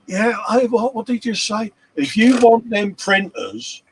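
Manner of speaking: hint of an outraged Lewis; mock Lewis. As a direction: ham up a startled bargainer. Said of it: "Lewis is like".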